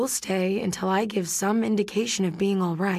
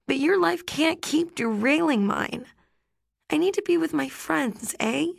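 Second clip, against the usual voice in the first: put-on higher girl's voice, sadly letting him down; higher voice of a teen girl saying no with care; Rhiannon, gentle but firm; 6 semitones higher than usual. A narrator's reading. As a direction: break it to him kindly but decide.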